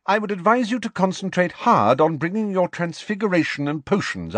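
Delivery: high-pitched, emphatic careers talk; stern professor. Acted. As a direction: advise a pupil with firm authority. McGonagall resumes advice.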